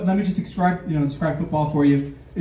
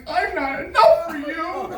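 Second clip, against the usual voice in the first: mock hurt; 12 semitones higher, slower than usual. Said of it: "what I'm hearing is".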